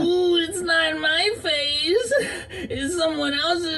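high, slow strained whimper; high panicked whimper. Acted. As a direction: squeak it out in distress. Panicking mid-accident.

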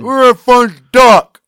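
loud, high, drawn-out holler; mock bellow. Imitating Jane arriving on the scene, mock bellow. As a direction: holler it slowly in a hammed-up voice. Imitates Jane's drunken yell.

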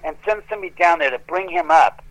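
ordinary delivery

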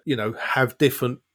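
usual speaking voice

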